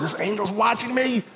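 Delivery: high, thin mock panic; freaked-out kid. Preacher recalls the halo turning at night, freaked-out kid. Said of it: "I'm just freaking out as a kid".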